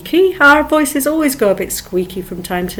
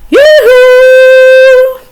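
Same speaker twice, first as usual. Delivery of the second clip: high, loud sung call; loud, high cheerful yoo-hoo; 14 semitones higher, 9 dB louder than usual. Shown as a demonstration. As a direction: call out across a field. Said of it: "So things like".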